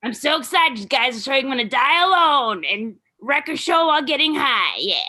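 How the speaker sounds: high, loud, bubbly YouTuber intro; happy YouTube host. Retold or imitated. Mocking YouTube hosts, high, gushing and cheerful. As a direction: gush like a relentlessly cheerful vlogger. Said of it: "they're like".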